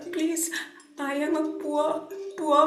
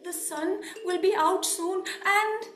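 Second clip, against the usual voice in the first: soft, higher, halting and stammering; frightened pleading; 4 semitones higher, 5 dB quieter than usual. Acted. Poor coolie begs merchant for a cloth, timidly. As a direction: plead meekly, tripping over the words. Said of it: "he was trembling in fear".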